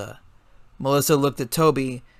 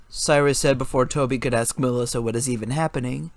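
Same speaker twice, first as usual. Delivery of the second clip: ordinary delivery, nothing marked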